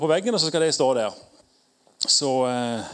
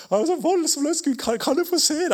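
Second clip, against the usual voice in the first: high, pleading Moses voice; a mock plea, voice jumping up; 10 semitones higher than usual. Moses begging God to let him see, high and pleading.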